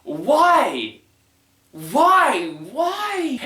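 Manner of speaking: hammed-up bewildered lament; exasperated disbelief. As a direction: repeat it in mounting comic despair. Despairing repeated question about the joke.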